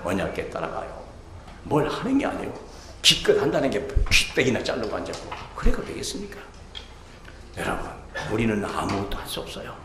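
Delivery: usual speaking voice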